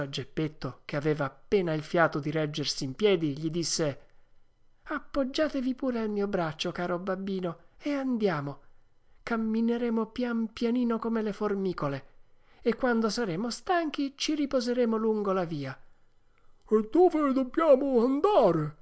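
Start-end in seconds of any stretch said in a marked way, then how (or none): 4.8-15.8 high, caring son's voice; Pinocchio. Acted. 16.7-18.8 put-on slow, high, louder old father's voice; Geppetto. A narrator's reading. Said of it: "domandò Geppetto".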